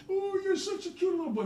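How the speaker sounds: high, laughing, squeaky granny voice; mock doting grandmother cooing at a boy; Italian grandma voice. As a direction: coo it like a doting grandma pinching a cheek. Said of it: "The Italian grandmother grabs up and goes".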